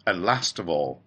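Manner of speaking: plain reading voice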